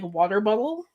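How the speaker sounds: "bubble" in place of bottle; "bottle" slipping into "bubble"; mispronounced water bottle. Shown as a demonstration. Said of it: "I wanna call it".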